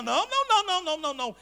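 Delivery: high, loud repeated não, fading; loud insistent denial; emphatic no. Retold or imitated. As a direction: hammer the refusal. God answers emphatically.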